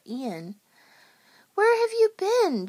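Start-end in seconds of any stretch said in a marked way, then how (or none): 1.5-2.7 high, loud demanding question. A narrator's reading. George to his late friends, loud demanding question. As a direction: ask it loudly, wanting an answer. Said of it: "George asked".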